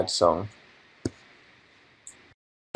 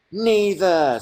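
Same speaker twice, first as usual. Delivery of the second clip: reading chat; 8 semitones higher, 4 dB louder than usual. Retold or imitated. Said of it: "said somebody".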